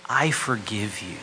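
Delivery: slow, loud and heartfelt; tender forgiveness. Shown as a demonstration. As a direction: give the words full weight, slowly. Preacher voices key gospel words.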